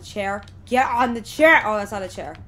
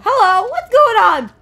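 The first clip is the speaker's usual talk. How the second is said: surprised greeting; 11 semitones higher, 14 dB louder, slower than usual. Real, not acted. Surprised by game event.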